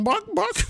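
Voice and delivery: high clucking buck buck; chicken clucks. Acted. Reader playing a bird clucks to friends, high. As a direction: cluck like a hen.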